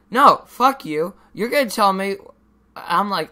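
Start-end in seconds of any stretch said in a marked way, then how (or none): 0.1-2.2 retold defiance. Defying teacher in class.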